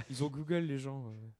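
normal talking voice

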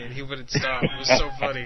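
ordinary talk

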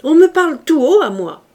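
loud, high and domineering; imperious shout. A narrator's reading. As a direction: demand respect at full voice. Javert insists on being spoken loudly.

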